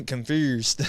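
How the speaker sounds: amused try at a Memphis accent on "confused". Shown as a demonstration. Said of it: "They be like".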